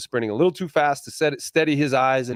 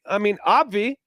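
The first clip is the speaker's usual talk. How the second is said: ordinary talk, nothing marked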